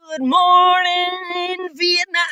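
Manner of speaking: high, drawn-out, loud DJ-style greeting; radio host greeting. Pretend. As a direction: stretch it out like a radio announcer. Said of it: "Oh, we're not in Vietnam".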